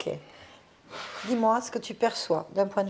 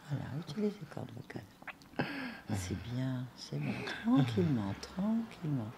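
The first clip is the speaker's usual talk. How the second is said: hushed soothing guide; 5 semitones lower, 6 dB quieter than usual. Acted. Medium whispers soothing to spirit.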